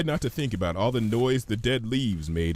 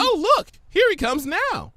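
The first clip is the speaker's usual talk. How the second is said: loud, much higher gleeful call; excited Pinky; 5 dB louder than usual. Acted. Pinky spots Gummy coming, gleeful shout. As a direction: squeal it with delight.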